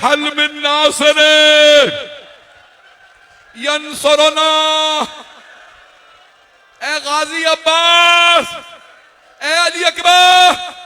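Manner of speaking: very high, loud, drawn-out calls; crying out. A narrator's reading. Imam calls fallen companions for help, crying out. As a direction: shout each name long and high, like a desperate summons. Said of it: "चीख चीख के कह रहे".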